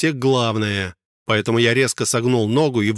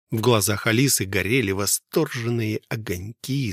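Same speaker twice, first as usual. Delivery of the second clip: ordinary delivery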